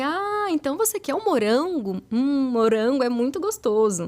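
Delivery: acted child-directed encouragement; modeling for a child. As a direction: gently stretch "morango" for a child. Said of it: "dar o modelo correto".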